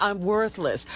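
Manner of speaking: usual speaking voice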